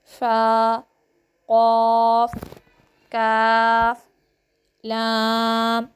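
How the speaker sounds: letter recitation. Shown as a demonstration. Teacher reads the letter board to pupils, slow loud recitation. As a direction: chant the alphabet board for children to repeat.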